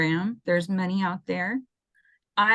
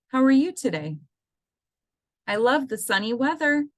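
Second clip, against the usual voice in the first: cheerful emphasis; 6 semitones higher than usual. Shown as a demonstration. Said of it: "using kind of that emotional emphasis".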